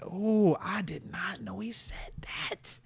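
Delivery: slow, hushed disbelief; stunned near-whisper. Real, not acted. Stunned by news he missed.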